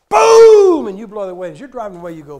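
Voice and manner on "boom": loud mock gunshot boom; big shouted gunshot sound. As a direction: bark out a gunshot. Mock gunshot in sermon story.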